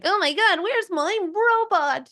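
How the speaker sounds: high, drawn-out mock panic; hammed-up fake despair; melodramatic wail. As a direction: overplay the lost robot for laughs. Example of overacted improv line.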